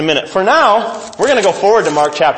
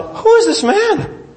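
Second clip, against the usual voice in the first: astonished high question; slower than usual. Acted. Disciples marvel.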